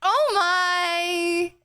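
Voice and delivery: ordinary delivery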